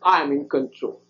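put-on soft, low and hushed, like a father calming a child; reassuring father. God soothing the worried preacher like a father, softly low. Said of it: "how an earthly father would say".

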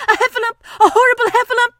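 high-pitched, loud terrified squeal; panicked cry. Acted. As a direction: raise the alarm in terror. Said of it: "cried Piglet".

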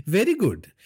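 high, chuckling Very good; laughing villain. Acted.

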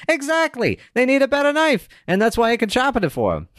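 high squeaky salesman. Acted. Squeaky sharpener scoffing at a dull knife. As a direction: squeak out the complaint. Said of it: "My, my squeaky voice is terrible".

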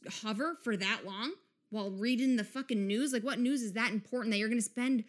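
usual speaking voice

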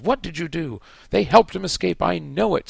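high, loud howl. A narrator's reading. Snape howls at Harry and Hermione, high and loud. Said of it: "Snape howled".

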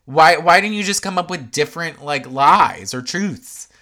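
loud, high, accusing demand; hammed-up demand for an answer; mock accusing shout. Confronting housewife, acted quote.

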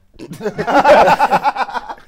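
loud, high burst of laughter; loud high laughter after a cheeky admission. Real, not acted. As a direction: let a big laugh burst out. Laughing after admitting it was fun.

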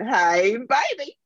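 put-on a Dallas husband walking in the door, Texas accent; channelled Texas accent. Dead husband greets his wife each night, Texas accent. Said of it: "That is not my accent".

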